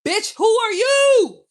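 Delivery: loud, high, slow outraged who are you; shouted retort. Retold or imitated. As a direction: yell it at him, high and slow. Angry retort at questioner.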